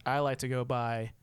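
usual speaking voice

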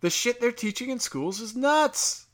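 usual speaking voice

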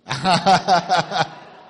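loud burst of rhythmic laughter; big staccato laugh. Real, not acted. Laughs while recalling funeral memories.

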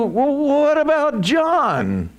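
high, drawn-out mock whine; mock Peter. Peter asking about John just after being restored, mock whining. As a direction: imitate a petty disciple pointing at someone else. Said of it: "and what does he do?".

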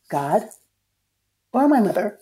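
usual speaking voice

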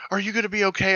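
high-pitched, mildly panicked question; mock fretting in a high voice; imitated freaking out. Imitating the shooter checking on the wounded player, freaking out. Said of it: "And she's freaking out".

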